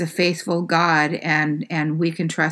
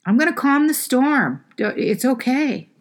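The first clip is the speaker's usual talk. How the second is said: higher, tender reassuring voice; soothing reassurance; 8 semitones higher than usual. Retold or imitated. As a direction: comfort frightened friends in the storm. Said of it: "a way of trying to soothe us".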